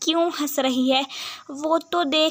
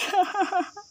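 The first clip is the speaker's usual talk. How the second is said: quick little laugh; a short giggle; 6 semitones higher than usual. Acted. Bride giggling after a sad question.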